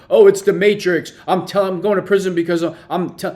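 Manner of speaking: high, loud mocking rant; fast, frantic delusional parody rant; wild unhinged ranting. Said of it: "They're just delusional".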